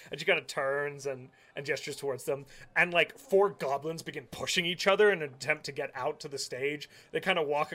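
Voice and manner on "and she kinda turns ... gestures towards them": ordinary delivery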